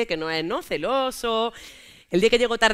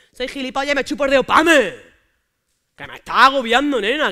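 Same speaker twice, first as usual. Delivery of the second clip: loud, higher angry shouting; angry yell; 5 semitones higher, 11 dB louder than usual. Retold or imitated. Fighting with boyfriend over his phone, loud angry yelling. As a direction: snap it out loud and exasperated.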